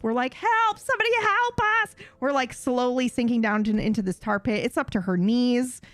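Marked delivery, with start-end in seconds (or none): 0.3-1.9 high, mock panicked cry; retold scream for help. Sisters sinking in a tar pit, screaming for help. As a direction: yell for rescue in a high voice. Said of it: "we're, like, screaming for help".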